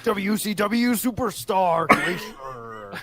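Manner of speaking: high, slow hype announcer; monster truck ad. Pretend. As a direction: belt it like a wrestling promo. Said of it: "like a monster truck commercial".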